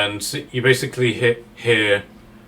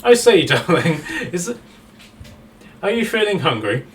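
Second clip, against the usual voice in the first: higher, posh and teasing, calling everyone darling; posh Frank voice; 7 semitones higher, 4 dB louder than usual. Acted. Captive Frank teasing the hungry troll, posh and camp. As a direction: play a camp captive, amused, high and loud.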